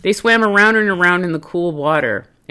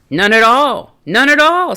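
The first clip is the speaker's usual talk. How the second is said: put-on old frog voice; 8 semitones higher, 7 dB louder than usual.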